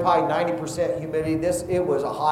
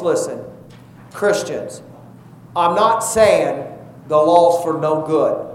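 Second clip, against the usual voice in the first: normal talking voice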